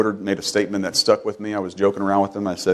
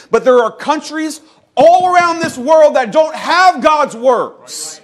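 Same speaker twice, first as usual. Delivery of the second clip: much higher and louder fiery sermon peak; preaching at full force; 11 semitones higher, 4 dB louder than usual. Acted. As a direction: thunder it out to the whole church.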